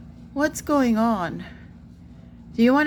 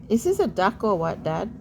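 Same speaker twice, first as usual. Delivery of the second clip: ordinary talk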